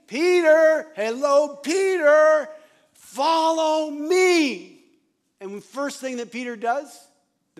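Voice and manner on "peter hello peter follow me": loud, high call for Peter's attention; hammed-up call of Jesus, high and loud; calling out loudly. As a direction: shout Peter's name across the crowd, then drag out the last words slowly. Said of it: "I find it funny".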